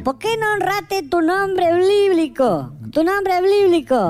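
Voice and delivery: high, drawn-out comic scolding; theatrical, stretched-out admonishment; mock reproach. Scolding the prisoner in acted quote.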